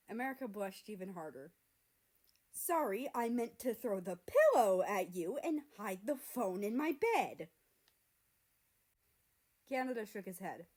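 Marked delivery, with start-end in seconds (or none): 2.5-7.5 much louder, embarrassed blurt; flustered apology. Acted. America blurts his excuse at Canada, loud and flustered.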